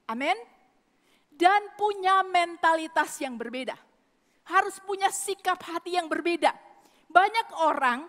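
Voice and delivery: ordinary talk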